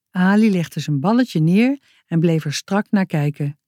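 ordinary delivery, nothing marked

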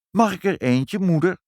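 put-on high, eager child's request; pleading child. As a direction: beg mother in a high little voice. Child begging mother for a kitten. (hoog, smekend kinderstemmetje)

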